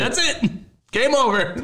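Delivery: high-pitched imitated outburst, half laughing; mock angry yell. League boss ending the game.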